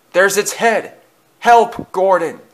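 high, loud alarm; panicked cry for help. A narrator's reading. Thomas sees the monster's head, high panicked cry. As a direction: cry out for rescue.

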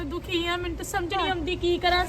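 hushed and quick; quiet quick complaint. Acted. Old woman grumbling secretly about her daughter-in-law, hushed. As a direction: confide it under the breath.

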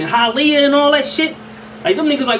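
ordinary talk, nothing marked

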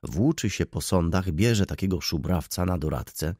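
plain reading voice

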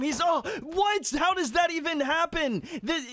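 can't believe what just happened; shocked disbelief. Real, not acted. Streamer reacting to a sudden death, stunned disbelief.

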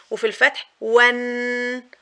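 ordinary talk

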